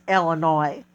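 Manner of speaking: "Illinois" ending in "noy"; Illinois with silent s. Shown as a demonstration. Confirming how the state is said, "Illinois" with a silent s. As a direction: say "Illinois" with no s at the end. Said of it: "not Illinois".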